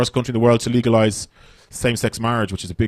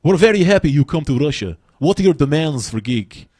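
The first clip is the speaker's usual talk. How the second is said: Russian promoter. Retold or imitated. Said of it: "the Russian dude kept sending me".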